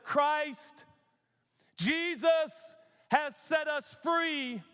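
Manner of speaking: high, slow, ringing declaration; emphatic scripture proclamation. Acted. Preacher declaring Galatians' call to freedom, emphatic. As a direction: stretch each word as a rallying cry. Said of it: "I'm emphatic about this".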